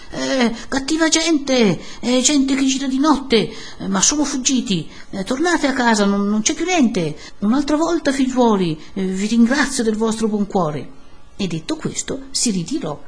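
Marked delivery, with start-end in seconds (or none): 0.1-10.9 high-pitched, hesitant 'eh' excuses; flustered priest. A narrator's reading. Priest wants the crowd gone, flustered calling. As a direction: fob the crowd off from a window.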